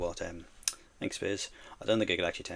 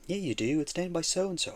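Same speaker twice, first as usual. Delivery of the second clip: mock Brit; 9 semitones higher, 4 dB louder than usual. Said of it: "people say".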